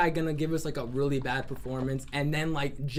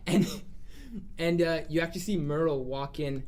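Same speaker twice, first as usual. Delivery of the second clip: ordinary talk, nothing marked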